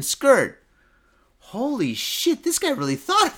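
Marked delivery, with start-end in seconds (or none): 1.4-3.3 amazed holy shit. Real, not acted. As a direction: marvel at the guy's nerve. Reacting again to the camera.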